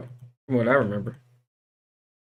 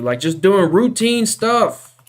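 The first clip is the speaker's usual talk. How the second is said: exasperated; 10 semitones higher, 8 dB louder, slower than usual. Real, not acted. Ranting about crashing programs.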